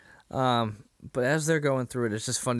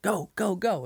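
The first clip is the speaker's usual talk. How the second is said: higher, hurried urging; urgent go go go; 9 semitones higher than usual. Retold or imitated. Workers rushing to fill jars, hurried higher urging. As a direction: hurry them along.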